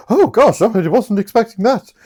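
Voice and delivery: high, loud flustered exclamation; hammed-up flustered lady; mock surprise. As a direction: act taken aback, pitched right up. Voicing surprised Olivia.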